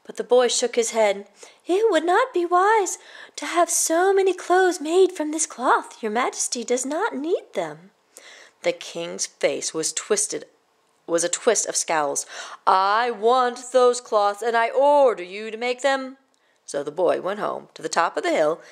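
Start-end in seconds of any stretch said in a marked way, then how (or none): 1.7-7.9 put-on high boy's voice, calm; boy turning the king down politely. Boy warns the king again. 12.7-16.2 slow, louder angry command; king scowling and commanding the boy. Acted.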